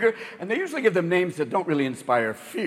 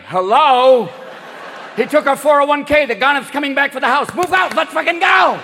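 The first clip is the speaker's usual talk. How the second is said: put-on higher, louder ranting old man; angry old Bernie; 8 semitones higher, 4 dB louder than usual. Hurricane Bernie ranting at Miami about the house, loud and angry.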